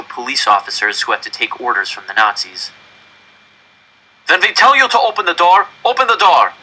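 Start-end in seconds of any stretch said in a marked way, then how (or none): 4.3-6.5 put-on loud, high, German-accented scolding; stern German officer. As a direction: lay down the rule coldly. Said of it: "the German officer told the adults".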